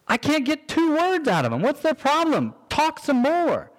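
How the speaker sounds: mock frustrated nag; exasperated complaint. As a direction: whine like a fed-up spouse who can't get a word out of them. Mock complaint about silent spouse.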